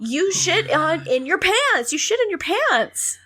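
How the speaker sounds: high, drawn-out exasperated protest; mock incredulous outrage. Reacting to a diaper blog post, mock appalled protest. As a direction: squeal it in appalled disbelief.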